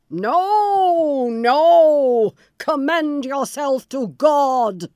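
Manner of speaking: loud, slow and final; merciless refusal. Acted. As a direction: refuse her with cruelty. Bluebeard refuses mercy.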